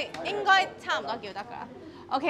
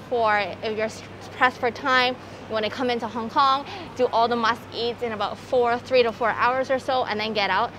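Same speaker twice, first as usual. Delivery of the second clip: muffled behind surgical mask; through a face mask; 7 dB louder than usual. Real, not acted. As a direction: mouth covered by mask.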